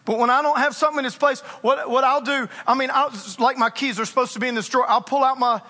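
ordinary delivery

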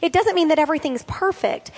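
ordinary delivery

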